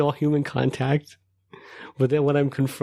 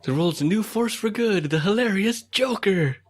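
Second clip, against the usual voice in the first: trailer announcer; 8 semitones higher than usual. Pretend. Hyping superhero like trailer.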